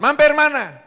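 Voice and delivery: high, loud nagging; furious wife berating her husband for coming home late; angry scolding wife. Acted.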